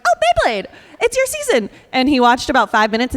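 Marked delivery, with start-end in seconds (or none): 0.0-1.7 much higher, gushing; excited husband. Retold or imitated. Husband on vacation finding the show, higher and excited. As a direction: cheer as if the show is a treat. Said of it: "my husband was like".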